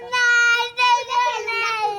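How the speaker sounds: repeated shouted protest; loud denial. Real, not acted. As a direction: shout it back insistently. Child protests after bike question.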